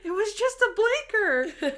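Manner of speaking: high, slow whiny protest; whiny teen. Retold or imitated. Teen driver protesting to the cops, high whine. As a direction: drag it out like a sulky teen.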